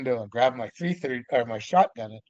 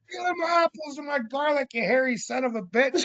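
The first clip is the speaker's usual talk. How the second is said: much higher, loud mock scolding; angry yell; 12 semitones higher, 4 dB louder than usual. Man yelling at Sasquatch for stealing his apples, angry yell. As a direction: holler at the thief.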